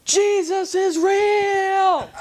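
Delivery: high, slow, loud proclamation; the saved man shouts his new faith, high slow yell; ecstatic shout. Retold or imitated. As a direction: stretch out each word as you shout it. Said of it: "And then he goes".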